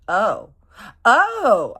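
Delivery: loud, high "oh", climbing on the repeat; shocked realization. Retold or imitated. She learns the chef has a fiance, shocked. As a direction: let the bad news land with a big oh.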